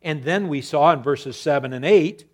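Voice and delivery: normal talking voice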